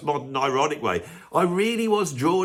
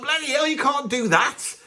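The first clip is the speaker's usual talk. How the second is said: much higher, indignant "Bloody hell"; shocked outburst; 11 semitones higher than usual. Retold or imitated. Reacting to the film's ending, shocked and indignant. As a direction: exclaim in disbelief.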